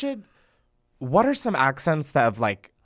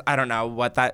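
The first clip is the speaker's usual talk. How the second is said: ordinary delivery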